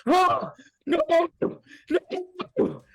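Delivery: high, loud scream of pain. Acted. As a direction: shriek as the fork goes in. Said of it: "She screams in pain".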